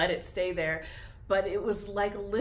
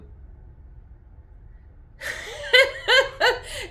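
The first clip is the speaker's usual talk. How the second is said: a wet laugh that breaks into crying; a real laugh-cry, high and loud; laughing through tears; 14 semitones higher, 7 dB louder than usual. Real, not acted. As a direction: laugh while you're still crying. Laughing while crying about it.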